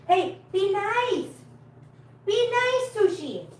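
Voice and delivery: very high, stretched-out coaxing reproach; pet scolding. Real, not acted. Carer to a rough cat, high-voiced scolding. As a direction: chide a pet in high pet-talk.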